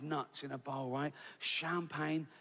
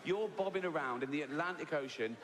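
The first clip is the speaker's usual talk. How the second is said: normal talking voice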